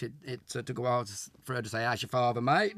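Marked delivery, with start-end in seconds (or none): 1.8-2.7 put-on a posh girl trying out Cockney; mock Cockney. Co-star imitating Caroline's attempt at Cockney, hammed up. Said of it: "with her well-spoken English".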